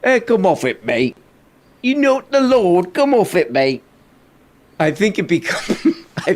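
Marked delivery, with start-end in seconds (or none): 0.0-3.8 a British bloke mocking a self-styled Lord; British come off it. Reactor imitating Piers scoffing at Russell. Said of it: "this is where the".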